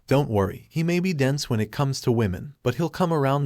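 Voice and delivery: ordinary delivery, nothing marked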